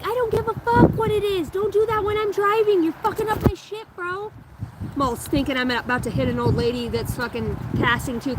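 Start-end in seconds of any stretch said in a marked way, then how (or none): 0.0-4.3 put-on high, loud frazzled yell at the kid; exasperated mom. Mother scolding son from the driver's seat, frazzled high yell. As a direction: snap back while trying to drive. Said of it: "And I'm like".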